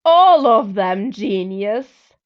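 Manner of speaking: loud, slow, sarcastic jab; exasperated retort. A narrator's reading. Jack mocks narrator's question, loud slow sarcasm. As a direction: drag out the obvious answer.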